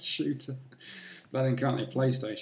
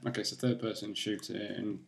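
ordinary delivery

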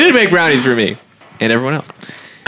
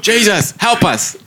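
mock plea; 6 semitones higher, slower than usual. Playing missionaries under attack.

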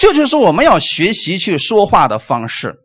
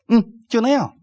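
a hedging shrug of an answer, lightly acted; noncommittal; 6 semitones higher than usual. Someone asked how life is going, mock noncommittal answer. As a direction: lightly imitate a vague, higher-pitched reply. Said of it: "都叫做模棱两可的话".

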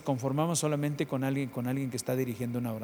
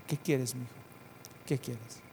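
softer, gentle fatherly asking; tender quiet voice; 8 dB quieter than usual. Acted. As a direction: ask softly like a loving father. Holy Spirit speaking tenderly.

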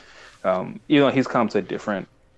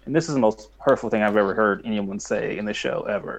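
normal talking voice

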